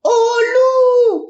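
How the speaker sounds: high, loud shout of wolf; full-force shout. Acted. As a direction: yell with all his strength. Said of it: "criait de toutes ses forces". (crier de toutes ses forces, aigu)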